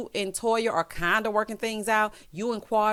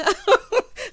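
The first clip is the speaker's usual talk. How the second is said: high burst of laughter; 5 dB louder than usual. Real, not acted. Laughing at her own joke.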